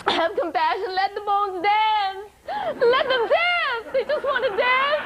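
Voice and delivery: high, slow, drawn-out mock wail; desperate pleading. Patients begging hospital to allow dancing, wailing plea. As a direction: beg tearfully, stretching the words, hammed-up. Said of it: "appealing to the head of the hospital administration".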